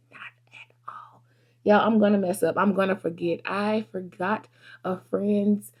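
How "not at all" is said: hushed confession to the camera; whispered aside. Real, not acted. Vlogger to her viewers, admitting flaws in a whisper.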